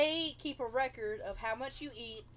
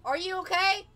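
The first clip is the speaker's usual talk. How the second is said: higher, louder brisk nurse check-in; mock loud nurse; 8 semitones higher, 8 dB louder than usual. Nurse barging in at night, loud chirpy check.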